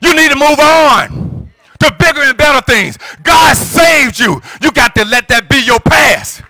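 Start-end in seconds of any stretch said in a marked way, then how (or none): none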